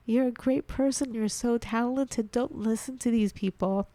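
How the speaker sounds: tearful, holding back sobs; crying. Real, not acted. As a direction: voice breaking with tears. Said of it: "Sorry, I'm crying now".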